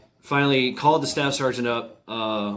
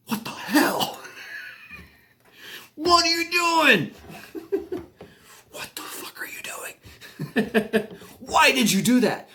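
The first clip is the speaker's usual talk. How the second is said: high, strained mock outrage through laughter; high-pitched incredulous scolding, half laughing; acted appalled disbelief; slower than usual. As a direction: imitate an aghast squad leader, breaking into laughs. Squad leader's appalled reaction, acted.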